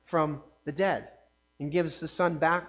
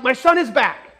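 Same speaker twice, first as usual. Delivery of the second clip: joyful father; 5 semitones higher, 8 dB louder than usual. Acted. Father overjoyed at son's return.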